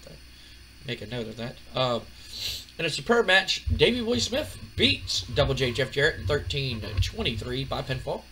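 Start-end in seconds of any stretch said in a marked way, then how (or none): none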